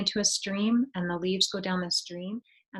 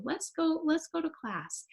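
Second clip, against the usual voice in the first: put-on higher, bright come-along to a child; cheerful coaxing; 8 semitones higher, 5 dB quieter than usual. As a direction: lift the voice to urge her along. Teacher cheerfully leads child to class.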